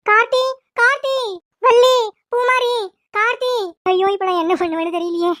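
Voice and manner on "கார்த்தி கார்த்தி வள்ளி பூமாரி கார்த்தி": high, urgent calls for each name; calling out. Acted. Mother calls for family at the house, urgent shouts. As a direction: call them out of the house, desperate.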